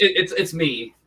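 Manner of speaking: tinny mic, like inside a can; tin can sound. Real, not acted. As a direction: speak through a cheap tinny microphone. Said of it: "so you don't sound like you're in a tin can".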